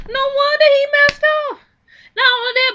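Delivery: high, loud exasperated exclamation; exasperated outburst. Real, not acted. Reacting to his past, high loud exasperated cry. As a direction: throw it up high in disbelief. Outraged commentary.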